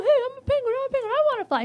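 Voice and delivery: high pleading penguin voice; whiny penguin. Acted. Penguin begging Santa to let it fly, high and pleading. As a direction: beg like a little penguin that wants to fly.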